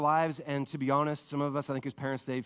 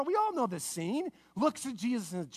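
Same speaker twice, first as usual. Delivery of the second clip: ordinary delivery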